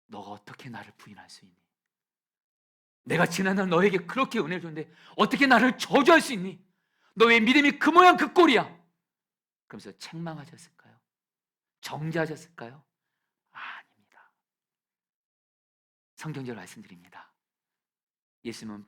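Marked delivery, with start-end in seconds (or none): 0.0-8.8 from hushed reproach to a high, loud scolding; imagined rebuke. Pretend. Jesus imagined scolding Peter, rising into a shout. 13.5-14.3 a soft whispered no. Real, not acted. Pastor answering his own question, whispering. Denial after rhetorical question.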